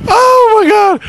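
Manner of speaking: high, loud shout; loud exclamation. Real, not acted. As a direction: yell it through laughter. Laughing fit mid-interview.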